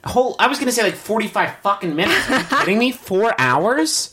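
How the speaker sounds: high-pitched disbelief; incredulous. Real, not acted. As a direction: squeak out the outrage. Incredulous reaction to four hours.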